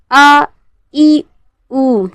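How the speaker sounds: isolated vowels "आ", "ई", "ऊ" with glottal onsets; hamza with jerk. Shown as a demonstration. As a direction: separate "आ", "ई", "ऊ", each begun with a catch. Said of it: "झटके के साथ अदा किया जाता है".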